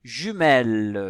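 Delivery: JUMELLE loud, with a held opening j; teacher's emphatic, lengthened JUMELLE; sounding out. Shown as a demonstration. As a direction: sound out JUMELLE so the first j is heard. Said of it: "Est-ce qu'on entend le J".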